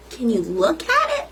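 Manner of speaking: plain reading voice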